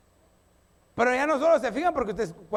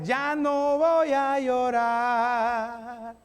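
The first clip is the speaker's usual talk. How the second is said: slow declaration; 2 semitones higher, much slower than usual. Shown as a demonstration. Congregation told to declare.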